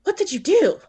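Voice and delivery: high-pitched, acted disbelief; retold alarm, voice jumping up; aghast question. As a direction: imitate a friend aghast at what just happened. Moses aghast in retold scene.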